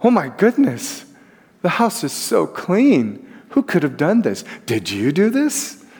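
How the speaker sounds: high, slow, mock-delighted wife's voice; husband imagining his wife thrilled at the house; imagined gushing praise. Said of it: "he's expecting".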